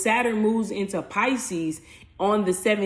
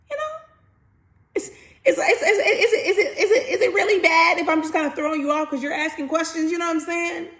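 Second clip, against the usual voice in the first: laughing; 9 semitones higher than usual. Real, not acted. Laughing while asking sheepishly.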